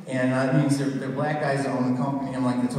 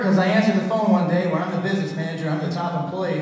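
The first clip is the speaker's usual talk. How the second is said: usual speaking voice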